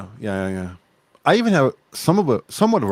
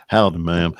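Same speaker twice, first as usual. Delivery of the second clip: Texas drawl; 8 semitones lower than usual. Retold or imitated. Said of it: "from Louisiana and Texas".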